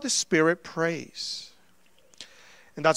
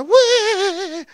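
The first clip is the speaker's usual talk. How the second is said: high imitated infant wail; long mock cry, calling for mom; baby wanting mom; 12 semitones higher, 4 dB louder than usual. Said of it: "I know he wants his mom".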